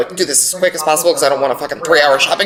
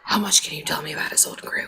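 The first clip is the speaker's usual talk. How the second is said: hushed whisper to the table; 5 semitones lower, 10 dB quieter than usual. Acted. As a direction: whisper it secretly. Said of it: "Stage whisper".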